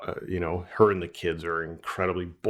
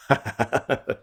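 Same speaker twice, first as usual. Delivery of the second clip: amused short laugh; 3 semitones higher than usual. Real, not acted. Speaker amused at wife's failed bet, chuckling. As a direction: chuckle at your own story.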